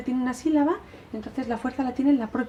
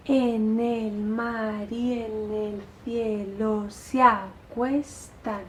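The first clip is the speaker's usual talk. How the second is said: slow, smoothly linked verse with vowels run together; recitado ligado; much slower than usual. Shown as a demonstration. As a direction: let the syllables flow into one another, lightly. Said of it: "aligerar la poesía, es muy ligera". (recitando ligado y ligero, uniendo las vocales)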